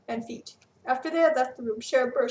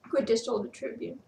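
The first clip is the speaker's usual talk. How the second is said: ordinary delivery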